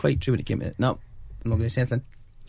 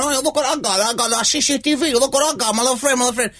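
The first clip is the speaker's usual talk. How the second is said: very high, excited gangster take-off; Scarface impression; 11 semitones higher than usual. Retelling the film's last stand, high comic Scarface impression. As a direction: squeal it as a cartoonish movie gangster. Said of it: "he's sitting there going".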